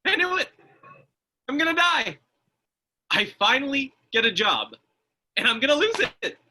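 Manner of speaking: high, loud hysterical wail; hysterical panic. Acted. Man told the agent is unstable, hysterical panic. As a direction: lose it completely, terrified. Learns he might die.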